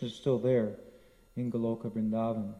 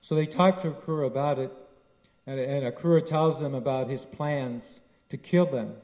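ordinary talk

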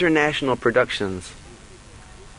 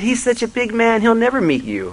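incredulous doubt; 10 semitones higher than usual. Retold or imitated. Disciple doubting, high.

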